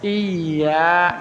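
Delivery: slow, soft "Iya" with a thin, long i; smiling kasrah. Shown as a demonstration. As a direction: spread the i of "Iya" as in a smile. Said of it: "kayak orang senyum". ("Iya" tipis seperti tersenyum)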